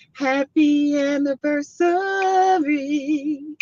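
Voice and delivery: slow, high, stretched happy anniversary; lingering celebratory greeting; drawn-out wish. Real, not acted.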